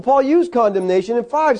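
ordinary delivery

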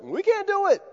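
high, pleading we can't; helpless protest. Retold or imitated. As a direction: throw up your hands, we can't. Disciples protest to Jesus.

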